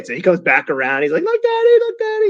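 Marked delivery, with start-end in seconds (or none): 1.2-2.3 put-on very high, loud little-kid voice; excited little kid. Little son calls out to dad while riding, squeaky kid voice. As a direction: squeal it like a small child showing off to a parent. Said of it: "what the little kids do".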